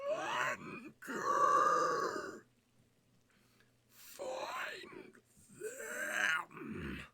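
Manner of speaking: piercing high ethereal demon shriek; demonic voice. Acted. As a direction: scream it like a demon from beyond. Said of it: "this piercing, ethereal, demonic voice".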